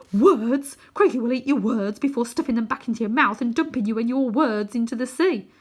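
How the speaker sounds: put-on loud, high scoffing boy; scornful outburst. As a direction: scoff at the idea, loudly.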